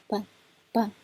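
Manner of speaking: 扮 said short and light; 扮 as a neutral-tone syllable. Shown as a demonstration. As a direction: clip 扮 quick and soft. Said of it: "后面这个扮就很轻".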